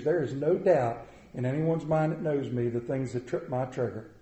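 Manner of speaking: usual speaking voice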